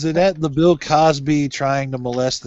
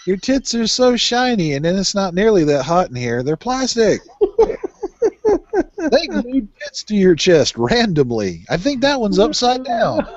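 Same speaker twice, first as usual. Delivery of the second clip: high teasing voice; 6 semitones higher than usual. Acted.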